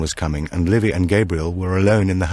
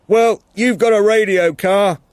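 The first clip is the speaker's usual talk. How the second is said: loud, high call over a gale; shouting in the wind; 14 semitones higher, 6 dB louder than usual. Acted. Martin tells Tilda to use her radio, shouting over the wind. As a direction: yell the practical answer across the snow.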